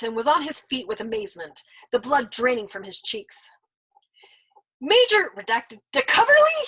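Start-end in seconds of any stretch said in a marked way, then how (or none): 4.8-6.6 higher, louder shocked cry. Acted. Nately shocked at the major's name, high cry. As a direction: gasp out the name in alarm. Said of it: "he cried".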